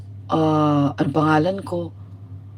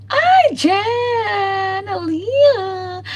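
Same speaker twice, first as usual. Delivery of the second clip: excited greeting; 11 semitones higher, much slower than usual. Real, not acted. Vlogger spotting her friends in the live chat, high excited call. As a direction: call out to a friend who just joined.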